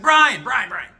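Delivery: high, loud calling out of a mom; son's mom shouting his name to get him up; mom yelling. Retold or imitated. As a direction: call the son's name like a nagging mom from downstairs. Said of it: "my mom's yelling at me".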